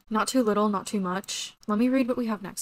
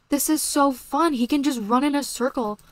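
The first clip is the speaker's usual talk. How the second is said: higher and louder, gushing with joy; thrilled; 5 semitones higher, 6 dB louder than usual. Real, not acted. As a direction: bubble over with excitement. Thrilled by horse lunging.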